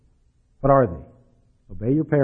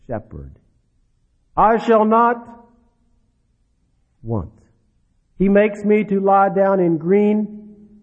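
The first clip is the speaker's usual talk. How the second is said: a small child reciting slowly, a hint of childlike sweetness; mock little-child recitation; sweet little child voice; 5 semitones higher, slower than usual. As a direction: lightly voice a toddler saying the psalm. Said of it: "you'll hear a sweet little voice".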